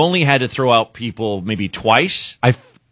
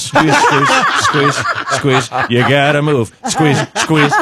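lightly voiced old man coaxing; playful mock coaxing squeeze squeeze; gentle teasing old guy; old bartender squeezing; 3 semitones higher, slower than usual. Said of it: "He's like".

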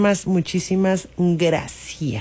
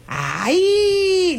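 mock wail; 11 semitones higher than usual. Mock complaint before scolding.

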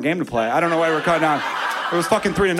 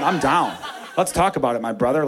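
ordinary talk, nothing marked

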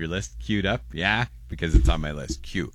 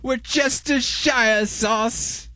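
high, drawn-out hammed-up British; slow, high mock-British flourish; fancy British accent; 4 dB louder, much slower than usual. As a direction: stretch it out in a lofty British voice. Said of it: "you have to say it like that".

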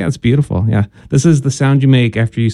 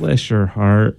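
Southern put-down; 4 semitones lower, 3 dB quieter, slower than usual. Acted. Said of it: "in a very polite way writing you off".

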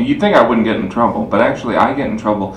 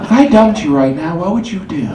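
put-on high girlfriend; 8 semitones higher, 4 dB louder than usual. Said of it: "she's like".

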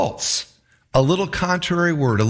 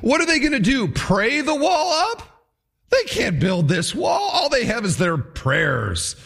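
high mocking question, slowing at the end; ridiculing; 7 semitones higher than usual. Sanballat ridiculing the builders' optimism, mocking. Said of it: "He ridicules their optimism".

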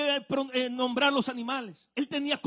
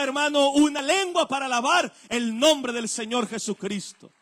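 loud, driving pulpit delivery; impassioned preaching; 7 dB louder than usual. Real, not acted. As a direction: preach it out forcefully, building to a loud peak. Predica con toda la fuerza. (predicación enérgica, a plena voz)

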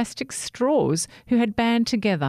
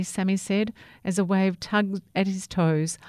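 ordinary delivery, nothing marked

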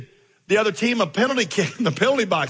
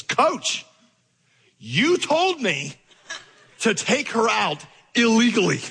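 put-on innocent kid; 5 semitones higher, slower than usual. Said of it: "and he says".

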